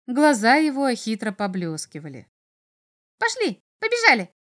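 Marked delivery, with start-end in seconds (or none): 3.2-4.3 very high, squeaky imp call; excited little devil. A narrator's reading. Little devil calls the boy to run along, excited. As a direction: urge him on in a piping voice.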